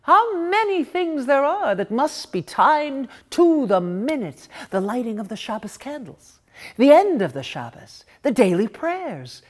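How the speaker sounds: ordinary delivery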